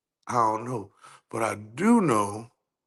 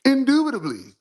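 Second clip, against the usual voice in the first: higher, louder mock-proper voice; 11 semitones higher, 4 dB louder than usual.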